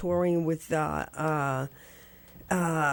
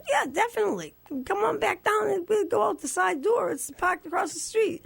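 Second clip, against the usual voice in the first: high, eager, bubbling yes; thrilled fan jumping at the chance; eager teen; 14 semitones higher than usual. Retold or imitated. Eager teen answering Frank.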